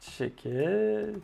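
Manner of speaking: clumsy put-on English accent on "set checked"; French coder saying "set checked" in bad English. Shown as a demonstration. Coder naming his React state, in a clumsy English accent. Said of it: "Désolé pour mon accent anglais abominable".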